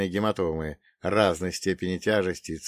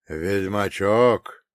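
slow, drawn-out recognition; surprised recognition; 5 dB louder, much slower than usual. Acted. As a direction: stretch it out in amazed recognition. Монах узнаёт героя, изумлён. (изумлённо, протяжно)

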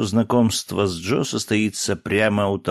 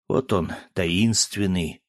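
plain reading voice

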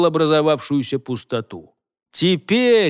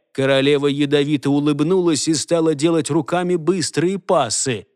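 ordinary delivery

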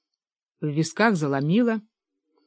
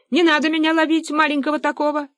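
very high, plaintive little voice; small creature pleading; 9 semitones higher, faster than usual. Acted. Fyodor Kuzmich begs hunters not to catch him, high pleading. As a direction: plead in a tiny high pipe.

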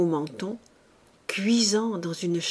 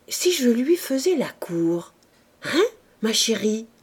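plain reading voice

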